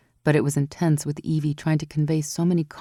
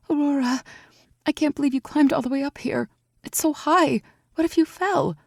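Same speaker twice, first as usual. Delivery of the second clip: raised voice for Evie, anxious and amazed; young woman Evie, worried; 10 semitones higher than usual. A narrator's reading. Evie amazed that Aurora climbed so high, worried. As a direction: lift your voice high, fretting over the climb. Evie's voice.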